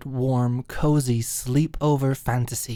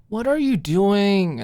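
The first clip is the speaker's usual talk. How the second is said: slow, higher, louder whine; whining; 7 semitones higher, 6 dB louder than usual. Retold or imitated. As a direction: drag it out like a sulky teen. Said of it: "whined would be the better word".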